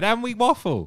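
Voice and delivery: high, mocking podcast girl voice. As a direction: parody the ad in a high voice. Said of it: "and then they said".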